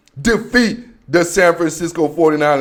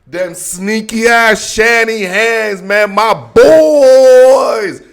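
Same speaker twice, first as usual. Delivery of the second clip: slow, drawn-out hype, building louder; hype intro; 4 semitones higher, 5 dB louder, much slower than usual. Acted. Host hyping up the team intro, slow then louder. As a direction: savour each word, then belt it.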